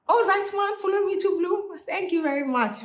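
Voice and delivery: high fake-cheery goodbye; mock cheerful brush-off.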